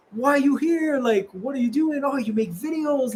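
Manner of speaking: high-pitched imitation of delighted locals; excited curiosity. Happy locals greeting a rare foreigner, excited curiosity.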